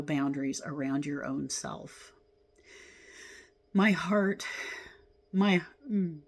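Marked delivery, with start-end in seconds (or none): 3.7-6.2 words breaking as emotion rises; real tearful catch; choked up. Real, not acted. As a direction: voice catching on tears. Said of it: "Oh, why do I cry?".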